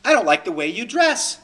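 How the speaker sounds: pointedly complain, easing off toward the end; critic. Acted. Someone criticizing Matt's way of dressing, mildly acted critic. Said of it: "Someone says to me".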